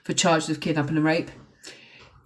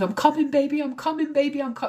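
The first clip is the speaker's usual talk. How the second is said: much higher, breathless rush of joy; urgent, emotional mum; 8 semitones higher than usual. Retold or imitated. Mum on the phone to her found daughter, high and urgent. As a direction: mum already halfway out the door.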